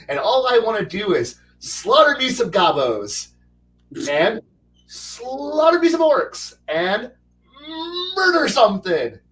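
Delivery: gleeful would-be murderhobo, squealing "slaughter!" high and loud; eager bloodthirsty newbie. Retold or imitated. Imitating a bloodthirsty new player.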